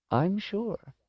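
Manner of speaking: put-on high, soft old-lady voice; tender elderly woman; reassuring old woman. A narrator's reading. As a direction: reassure gently, pitched well up.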